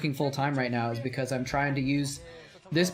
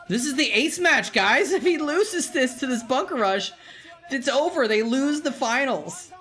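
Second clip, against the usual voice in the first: hyped; 8 semitones higher than usual. Real, not acted. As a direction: pump the chat up, the whole final rides on this. Hyped about decisive ace match.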